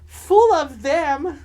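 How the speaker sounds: laughing loud and high; bursting into laughter. Real, not acted. Laughing drag banter.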